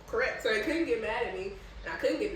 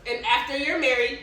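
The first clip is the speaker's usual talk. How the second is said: higher, louder pointed interjection; dad's loud correction; 5 semitones higher, 10 dB louder than usual. Retold or imitated. Dad loudly adds a rule mid-explanation, pointed. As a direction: cut in firmly to add the rule. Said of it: "And my dad goes".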